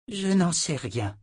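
a touch of resigned sighing; sighed admission. A narrator's reading. Sorceress admitting she does not know, lightly sighing. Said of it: "soupira Perdita".

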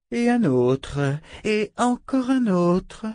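slow, climbing, high-pitched relish; sadistic, drawn-out enjoyment; gleeful cruelty. A narrator's reading. Torturer demonstrating sore spots on her victim.

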